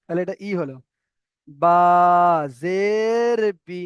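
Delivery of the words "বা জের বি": held "বা", long arched "জের", short "বি"; spelling demonstration. Shown as a demonstration. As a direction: stretch "বা জের" slowly before "বি". Teacher models letter-by-letter spelling.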